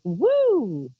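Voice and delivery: high loud whoop, full of energy; excited woo. Shown as a demonstration. Psychic showing her hyper mood to listeners, excited whoop. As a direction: throw out a giddy woo. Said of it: "when I'm this kind of like".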